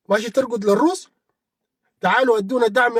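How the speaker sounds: ordinary delivery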